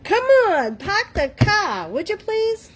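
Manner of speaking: high, loud, hammed-up Boston accent; утрированный бостонский акцент. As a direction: play up the Boston accent in mock protest. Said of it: "You making fun of my Boston accent?".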